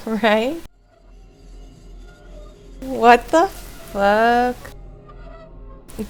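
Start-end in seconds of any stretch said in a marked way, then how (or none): none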